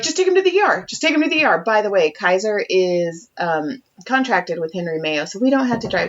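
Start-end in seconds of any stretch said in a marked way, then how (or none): 0.0-1.6 high-pitched mocking imitation, repeated insistently; nagging advice. Mom mimicking her partner's phone advice, mock insistence. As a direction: mimic breezy advice in a raised voice. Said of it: "you're like".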